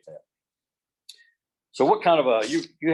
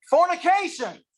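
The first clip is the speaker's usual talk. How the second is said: much higher, put-on old lady voice; grandma's toast; 4 dB louder, slower than usual. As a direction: play the prankster great-grandmother announcing the toast. Grandmother's prank toast at party.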